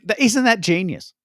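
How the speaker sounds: ordinary talk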